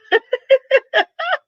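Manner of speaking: high burst of laughter. Real, not acted. Streamer laughing at the chat.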